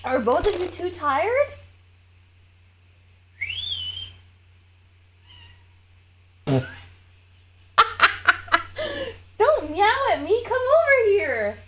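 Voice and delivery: high, sing-song pet voice; calling the cats. Real, not acted. Calling her cats for emotional support, high coaxing.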